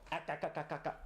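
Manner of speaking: string of short stuttered syllables; tongue-tied stammering. Real, not acted. Streamer who can't even talk, tongue-tied stuttering noises. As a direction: trip over your tongue in quick little bursts. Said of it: "I can't even talk".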